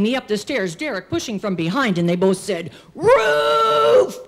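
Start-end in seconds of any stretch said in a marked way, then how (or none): 2.9-4.2 high, loud, drawn-out yell; long gleeful shout; boys' rallying cry. Acted. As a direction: holler the destination like scheming boys. Boys announce the roof together.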